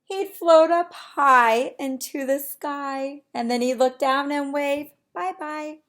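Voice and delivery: bright, lively storybook reading; animated read-aloud. Acted. Teacher reading to her class, lively storybook voice. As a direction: read it big for the little ones.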